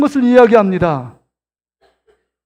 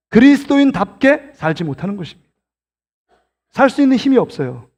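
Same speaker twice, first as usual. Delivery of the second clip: ordinary talk, nothing marked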